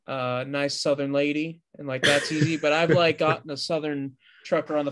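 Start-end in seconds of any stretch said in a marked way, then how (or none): none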